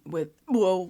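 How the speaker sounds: a sudden crack in the voice on "whoa". Real, not acted. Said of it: "why did I voice crack?".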